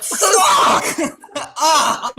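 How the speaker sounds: very high, very loud yell; pained scream. Retold or imitated. Recreating being hit with acid, very high loud scream.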